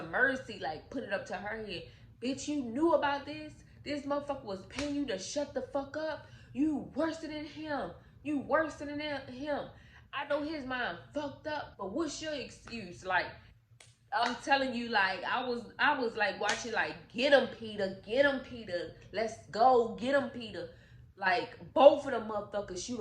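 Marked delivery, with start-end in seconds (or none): 2.2-13.0 higher, half-acted angry husband; mock furious accusation. Peter confronts his wife. 17.1-20.7 louder excited cheering at the screen. Real, not acted.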